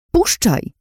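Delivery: high, sharp angry order; snarled command. A narrator's reading. Fighting free of his grip, snarled command. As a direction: snap it out, pitched well up. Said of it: "warknęłam".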